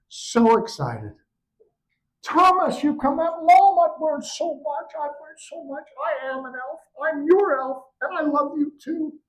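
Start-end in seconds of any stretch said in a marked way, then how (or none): 2.2-3.4 high thrilled mom's voice; excited mother. Acted. Mother greeting her returning son, high thrilled voice. 3.4-9.2 put-on high earnest child's voice; happy child. Son telling his mother he is an elf, high earnest voice.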